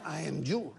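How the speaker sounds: put-on low Mexican-accented voice, half laughing. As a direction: insist it, deep and accented. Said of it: "No, no, no".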